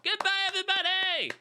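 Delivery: cheerful outro shout to the listeners; excited goodbye. Real, not acted. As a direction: yell goodbye to the audience with gusto. Podcast sign-off.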